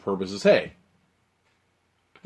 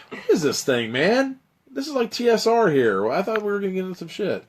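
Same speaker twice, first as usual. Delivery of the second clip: high-pitched, louder disbelieving whine; incredulous complaint; 11 semitones higher, 4 dB louder than usual. Real, not acted. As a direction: protest the silly adventure in mock exasperation. Laughing complaint about the adventure.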